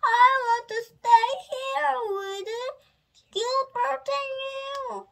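high, loud, wailing plea; sobbing plea. A narrator's reading. Little princess begging to stay home, high wailing plea. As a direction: plead loudly, stretching the end. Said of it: "sobbed the little princess".

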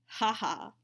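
short hearty ha-ha; breezy colonial chuckle; breezy laugh. A narrator's reading. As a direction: toss off a bluff, easy laugh. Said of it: "He laughed breezily".

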